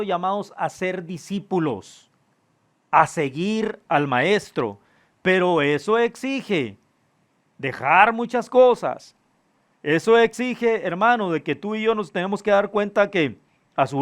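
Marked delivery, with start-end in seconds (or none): none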